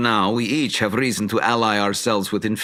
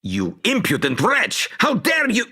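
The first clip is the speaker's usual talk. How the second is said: angry; 11 semitones higher than usual. Acted. Wizard explodes at insult.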